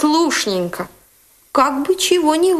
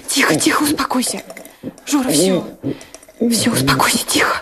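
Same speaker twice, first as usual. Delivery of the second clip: low, calming murmur; lowered, reassuring voice; soothing; 7 semitones lower, faster than usual. Acted. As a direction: settle someone who is sobbing. Soothing a sobbing boy.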